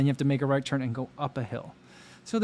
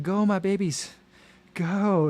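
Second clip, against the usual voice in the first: high-pitched pretend pacer cheer; mock cheering; 7 semitones higher, slower than usual. Pretend pacer to runners on the last mile, mock cheering. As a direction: urge them on, higher than usual.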